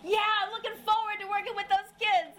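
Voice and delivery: husband cheerfully volunteering for nursery duty, overjoyed; eager yes. Retold or imitated. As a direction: play the delighted husband who can't wait.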